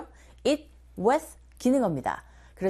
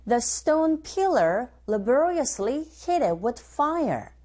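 slow, careful drill reading; teacher modelling the phrase slowly; slow model line; 2 semitones higher, much slower than usual. Shown as a demonstration. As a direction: say it slowly for students to copy. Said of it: "고고 리스닝 one".

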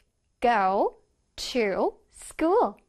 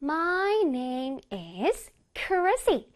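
"My name is Chrissy" stretched note by note; very slow, melodic "My name is Chrissy"; piano-like intonation; much slower than usual. Shown as a demonstration. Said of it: "내 몸이 피아노가 된다고 생각해야 돼".

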